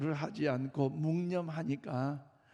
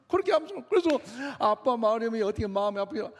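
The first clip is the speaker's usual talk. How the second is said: usual speaking voice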